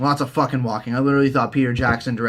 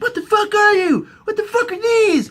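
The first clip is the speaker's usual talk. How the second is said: very high horrified squeal; much higher hammed-up panic; shrieking Sansa. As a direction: shriek in mock horror, near tears. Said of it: "It's like".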